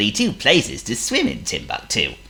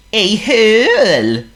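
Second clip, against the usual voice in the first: high, loud, slow seal voice; Honk the seal; 10 dB louder, slower than usual. Acted. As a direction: play a polite silly seal. Honk repeats.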